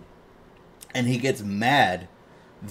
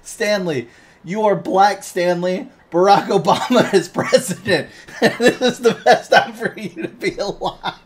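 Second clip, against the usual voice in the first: high, laughing pep talk; rally him up like a clueless boss; hammed-up urgent cheer; mock frantic encouragement; 8 semitones higher, 3 dB louder than usual. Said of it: "He's like".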